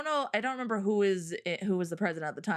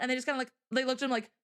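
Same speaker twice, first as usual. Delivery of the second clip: ordinary talk, nothing marked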